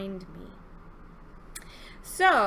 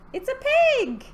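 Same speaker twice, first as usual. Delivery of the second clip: high, loud announcement; excited reveal; 12 semitones higher, 6 dB louder than usual. Real, not acted. Revealing the animal to children, excited. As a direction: call out the answer with delight.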